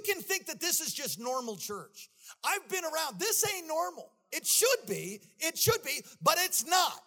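high, loud shouted preaching; full-force preaching. Acted. Preacher exhorting the congregation, shouting high and loud. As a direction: preach it with everything.

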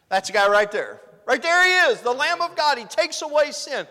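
high, very loud cries; excited shouted announcement. Acted. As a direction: shout it out to a crowd of onlookers. Acts John's proclamation again.

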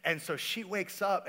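ordinary delivery

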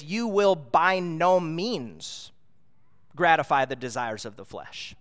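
slow, weighty stress on "You will by no means"; preacher driving the verse home, slow and emphatic. Shown as a demonstration. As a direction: lean hard on "by no means", drawn out slowly. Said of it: "it's emphatic".

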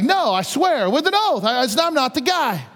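high-pitched, protesting oath; emphatic denial. Acted. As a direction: act out swearing you're innocent. Peter acted denying with an oath.

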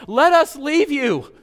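high, loud, slow pleading demon voice; preacher voicing the demons begging to leave. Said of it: "The demons begged".

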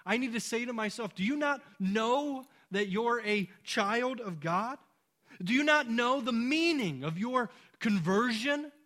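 loud, high, building sermon cry; rally the congregation to answer yes; preacher urging the church to remember who they are, loud and rising; impassioned preaching. Acted. Preacher building to emphatic climax.

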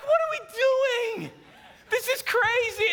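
very high, mock-desperate squeal of protest; exasperated. Husband protests the frantic cleanup, exasperated. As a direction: cry out like a husband at wit's end.

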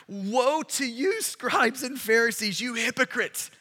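drawn-out woe to you; slow weighty woe. Acted. Preacher reading to the crowd, slow and weighty. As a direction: let the woe land slowly.

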